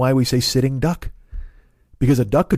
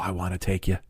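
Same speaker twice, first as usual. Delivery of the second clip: whisper; 5 semitones lower, 8 dB quieter than usual. Acted. Teasing aside to listeners.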